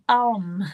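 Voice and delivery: "Alm" as one syllable, no final vowel; tentative pronunciation. Shown as a demonstration. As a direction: try "Alm", ending on the m. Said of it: "don't know how to pronounce it".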